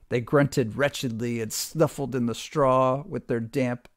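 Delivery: ordinary delivery, nothing marked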